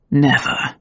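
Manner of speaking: low promise. A narrator's reading.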